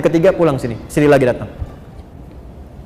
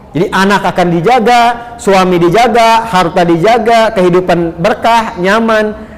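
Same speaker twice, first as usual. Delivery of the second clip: ordinary delivery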